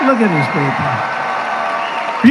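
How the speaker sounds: slow, savoring; slow admiring. Real, not acted. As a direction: linger on each word, slowly. Pointing out the crowd again, admiring.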